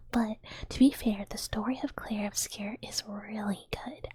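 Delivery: ordinary talk, nothing marked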